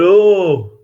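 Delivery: mock dopey Yorkshire local; imitating a slow-witted local from up north; Yorkshire dialect. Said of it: "That's how they talk around, around here".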